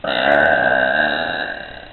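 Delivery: deep drawn-out belch; one long rumbling burp. Real, not acted. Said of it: "I am the burping queen".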